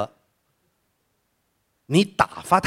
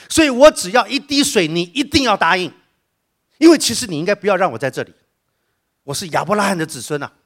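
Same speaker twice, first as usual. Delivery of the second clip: rich man's loud plea; 6 semitones higher, 7 dB louder than usual. Acted. The rich man in Hades pleads loudly with Abraham. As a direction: demand the water, aggrieved and insistent.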